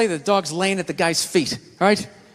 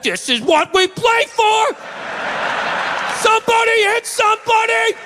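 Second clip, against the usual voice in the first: pumped-up battle cry; 14 semitones higher than usual. Retold or imitated. Dog hyping itself up like a linebacker, shouting. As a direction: fire up the team in the tunnel.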